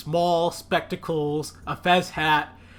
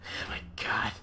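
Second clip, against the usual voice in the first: whispered despair; 4 semitones lower, 12 dB quieter, slower than usual. Acted. Despairing aside.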